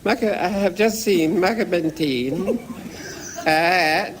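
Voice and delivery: high, laughing through it; lightly voiced eager young man; prim scout voice. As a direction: imitate him proudly declaring. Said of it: "He said".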